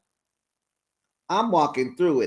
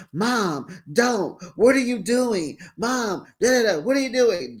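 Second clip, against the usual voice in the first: higher pleading daughter; alarmed daughter; 5 semitones higher than usual. Retold or imitated. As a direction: plead with mom, a bit high. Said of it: "Chantel is talking to Jackie".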